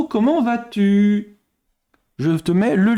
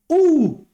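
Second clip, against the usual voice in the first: surprised hoot; 10 semitones higher, 5 dB louder than usual. Real, not acted. Reacting to a quiz picture.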